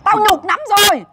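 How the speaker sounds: high, aggrieved outcry; hard, loud complaint. Real, not acted. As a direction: cry out the humiliation to the debtor. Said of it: "bây giờ phải cứng". (kêu lên uất ức, giọng cao)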